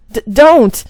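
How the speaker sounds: loud, panicked outburst; stammered shriek. Acted. Stopping Marple from taking the cream, stammered shriek. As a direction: catch on the first sound, then shriek it. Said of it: "She let out a shriek".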